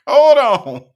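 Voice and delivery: high, very loud laughing plea; laughing outburst. Real, not acted. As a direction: shout it through a laugh. Cracking up before replaying clip.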